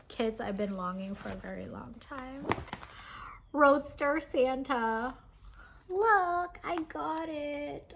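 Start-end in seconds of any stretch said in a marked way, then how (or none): 3.5-5.1 high, loud, slow reveal; excited, high-pitched. Real, not acted. Vlogger revealing a longed-for kit, excited high voice. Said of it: "My voice is getting really high pitched". 5.9-7.9 high, stretched-out squeal of triumph; excited, high-pitched. Real, not acted. Vlogger showing off her find, high-pitched excitement. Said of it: "My voice is getting really high pitched".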